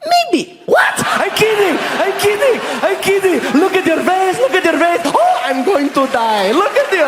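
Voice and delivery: put-on high, squealing Saudi-accented glee; gleeful teasing. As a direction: crack up at your own prank. Saudi local cracks up at own joke.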